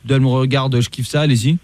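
stern police officer. Pretend. Host posing as police commissioner calling the rapper, stern. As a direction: play a stern cop on the phone. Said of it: "Je suis le commissaire Latour".

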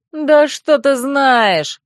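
high, loud, drawn-out protest; indignant cry. A narrator's reading. Fox crying out at the mocking student, indignantly. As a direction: shout back, stung by the taunt. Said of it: "крикнул Динор".

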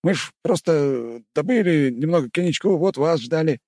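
high, frightened bleating voice; scared bleat. Acted. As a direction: bleat it out, caught red-handed. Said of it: "испуганно проблеял усатый".

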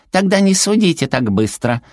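high, cutesy rebuke; huffy little creature. A narrator's reading. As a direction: scold in a small high voice. Зверёк укоряет Твайлу. (укоризненный высокий голосок зверька)

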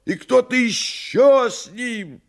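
high, loud panicked yell; frightened shout. Acted. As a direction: cry out in sudden fright. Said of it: "испуганно закричал".

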